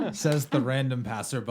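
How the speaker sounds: loud, laughing aside. Real, not acted. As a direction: joke it out mid-laugh. GM jokes, laughing loudly.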